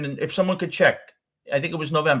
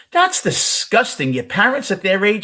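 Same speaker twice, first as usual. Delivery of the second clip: joking mock disgust; 9 semitones higher, 6 dB louder than usual. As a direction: ham up the disgust as a tease. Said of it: "I look at her jokingly".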